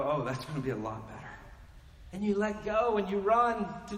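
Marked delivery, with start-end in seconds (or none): 0.0-1.2 glad relief. Retold or imitated. Believer welcoming death.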